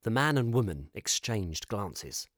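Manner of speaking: ordinary delivery, nothing marked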